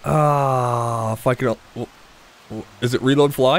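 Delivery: ordinary delivery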